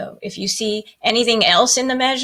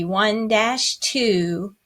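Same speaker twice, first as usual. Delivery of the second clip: slow, even count; teacher slowly counting one dash two; counting the beats; much slower than usual. Shown as a demonstration. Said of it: "to count it".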